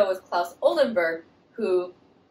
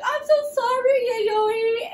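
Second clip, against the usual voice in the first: much higher, sugary sorry; high fake-sweet apology; 14 semitones higher, 3 dB louder, slower than usual. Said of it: "Oldenburg's wife is like".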